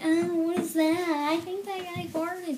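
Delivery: slow, sing-song mock kid voices; lightly voiced kids sniffing the air; kids reacting. Kids in the theater reacting to the smell, sing-song mock voices. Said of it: "all these kids are like".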